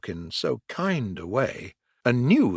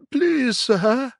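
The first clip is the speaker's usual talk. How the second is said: pleading washerwoman; 13 semitones higher, 4 dB louder than usual. A narrator's reading. Toad pleads.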